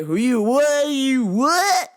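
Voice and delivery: baffled country drawl; mock outraged yokel. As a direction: sputter like an old-timer who can't believe it. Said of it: "Now I sound like the dude".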